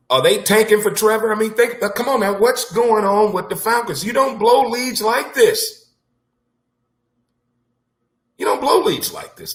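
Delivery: high-pitched, incredulous sports-fan complaint; worked-up fan venting in a high voice; exasperated rant. Real, not acted. Exasperated rant about blown leads.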